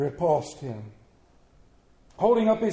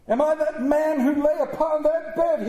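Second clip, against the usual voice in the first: high-pitched cry of dread; pleading, raised voice; anguished cry; 8 semitones higher, 4 dB louder than usual. A narrator's reading. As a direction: cry out like a desperate man on his knees. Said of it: "he cried on his knees".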